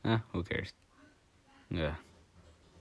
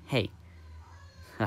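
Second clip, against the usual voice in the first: normal talking voice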